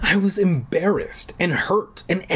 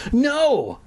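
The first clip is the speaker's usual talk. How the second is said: shriek; 11 semitones higher than usual. A narrator's reading. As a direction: squeal it out in panic. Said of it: "I shrieked".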